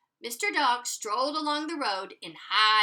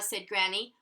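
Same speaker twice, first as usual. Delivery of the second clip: ordinary delivery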